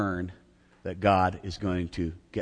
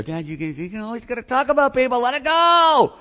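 high mock whine of the sons; nagging sons, pitched way up; imitating his sons; 13 semitones higher, 4 dB louder than usual. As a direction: voice the fed-up kids, much higher. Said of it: "they go".

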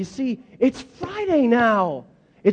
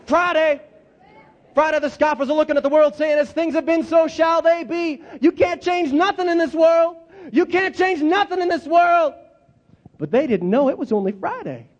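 preaching at full force; 8 semitones higher than usual. Shown as a demonstration. Leading the congregation in call-and-response preaching, rising to a shout. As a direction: climb and roar the last line. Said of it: "Let's try it again".

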